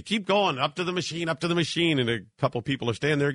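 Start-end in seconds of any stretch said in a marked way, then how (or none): 0.0-2.0 higher-pitched mock yell at the crowd; retold yelling. TSA agent yelling at travellers, mock shout. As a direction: bark the line back at the queue like the agent. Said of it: "He starts yelling".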